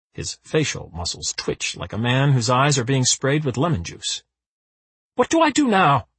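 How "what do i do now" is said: high panicked roar. A narrator's reading. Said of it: "roars Parvana".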